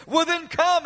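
high, pleading cry. Acted. John joins the spirit of prayer, crying out high. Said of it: "this is John crying out".

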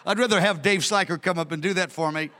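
usual speaking voice

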